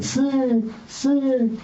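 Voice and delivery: high-pitched call at normal volume; imitated scream. Man retells neighbour screaming outside his window, mock high scream. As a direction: mimic a drawn-out scream without the volume. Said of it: "he starts screaming".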